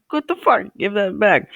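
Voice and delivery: high-pitched retold outrage; indignant protest. Storyteller replays telling kid to give squirt gun back, indignant and high. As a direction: pitch jumps up in startled protest.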